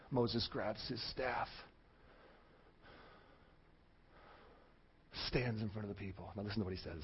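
low, very soft storytelling build-up; hushed preaching. Real, not acted. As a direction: drop right down to draw the congregation in.

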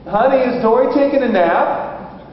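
put-on high, careful question to a child; coaxing father. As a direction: imitate a dad gingerly sounding out his little girl. Father gently questions young daughter.

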